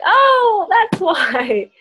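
laughing at the realization; mock surprised aha. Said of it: "I'm like".

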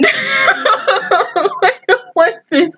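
slow, high sobbing plea; crying in dread. Real, not acted. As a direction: drag it out in a high sob. Dreads coming deaths, breaks down.

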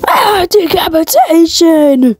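high, drawn-out cry of horror; mock scream. Commentator reacts to a decapitation, mock screaming. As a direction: stretch it into a high-pitched hammed-up wail.

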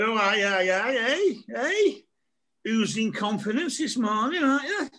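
high, sing-song mocking tease at a cocky kid; mock teasing. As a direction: rib him like a dad showing off. Dad teasing cocky son.

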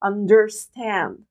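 very slow "Understand", "un" and "der" weak, "stand" long and strong; stress on stand. Shown as a demonstration. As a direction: drag out "stand". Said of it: "عم ركز عليه أكثر من المقاطع". (نطق بطيء مع ضغط على stand)

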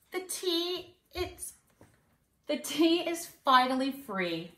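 storybook glee; delighted leprechaun. Acted. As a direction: savour the treat like a greedy little sprite.

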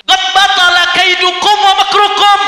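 slow, high, loud Arabic cry; drawn-out proclamation as the devil's chief; acted loud declamation. Preacher voicing Iblis declaring their schemes void, loud declamation. Acting Iblis addressing his minions.

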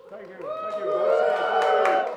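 very high, loud squeak; squeaky thank you. Acted. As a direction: squeal your thanks to the crowd. Acknowledging imagined applause.

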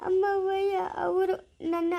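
slow, tearful begging; crying plea. Acted. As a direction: sob it out, pleading with mother. Son begs mother, crying.

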